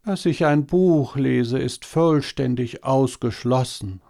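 entschieden, etwas höher, Faultierstimme; Faultier. A narrator's reading. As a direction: lehne es träge, aber bestimmt ab. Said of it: "sagte das Faultier". (Faultier, träge und bestimmt ablehnend)

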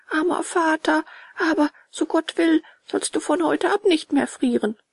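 high-pitched earnest promise; compassionate puppet boy. A narrator's reading. Bengele pities his father. (hohe, mitfühlende Jungenstimme)

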